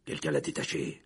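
low executioner's grumble; growling. A narrator's reading. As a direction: growl it far down in pitch. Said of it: "grogna le bourreau". (grogné, voix grave)

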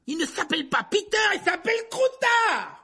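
very high, indignant shout; yelling. A narrator's reading. Ron defends his rat, yelling. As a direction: scream the correction at full force. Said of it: "hurla Ron".

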